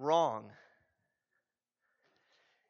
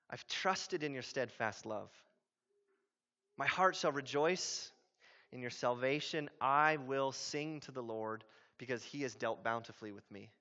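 quiet trust; 5 semitones lower, 7 dB quieter than usual. Acted. Reading the psalm's trusting ending.

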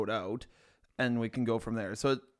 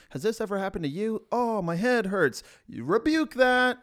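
infomercial voice; 9 semitones higher, 5 dB louder than usual. Pretend. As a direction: sell it like a late-night ad. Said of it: "It sounds like an infomercial".

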